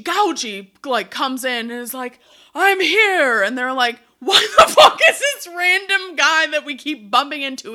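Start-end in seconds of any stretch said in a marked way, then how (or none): 2.5-3.4 high, loud announcing shout; hammed-up arrival, pitched way up; mock grand entrance. Acting Luigi's sudden entrance. 4.2-7.7 loud, high, laughing disbelief; hammed-up bafflement with a laugh; exasperated mock outburst. Acting the others' baffled outburst, laughing.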